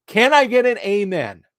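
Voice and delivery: loud, high call for an amen; preacher call. Acted. Rallying YouTube viewers like a congregation, loud and high. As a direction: belt it out like a preacher to the crowd.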